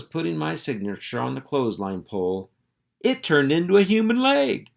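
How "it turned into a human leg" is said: much higher and louder in shock; astonished. A narrator's reading. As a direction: blurt the reveal in disbelief. Narrator's shocking reveal.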